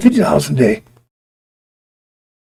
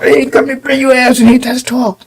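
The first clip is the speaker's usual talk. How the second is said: higher, shouted boss summons; mock boss calling out; 8 semitones higher than usual. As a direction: yell like the boss calling you in. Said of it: "he'll call you out".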